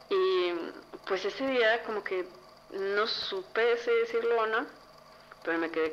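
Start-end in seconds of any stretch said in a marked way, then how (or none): none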